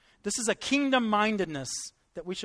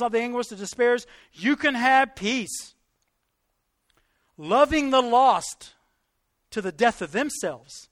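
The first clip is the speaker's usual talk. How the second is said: raised, emphatic sermon delivery; fervent preaching; 2 semitones higher, 5 dB louder than usual. Real, not acted. Preacher assuring believers of peace and heaven, fervently. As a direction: press each phrase home to the congregation.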